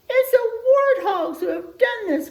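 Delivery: high, loud accusing cry; crying out accusingly. Acted. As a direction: point the finger with a shout. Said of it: "cried the other animals".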